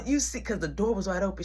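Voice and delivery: normal talking voice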